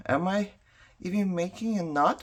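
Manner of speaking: normal talking voice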